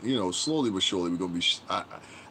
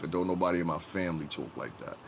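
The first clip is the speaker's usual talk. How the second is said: usual speaking voice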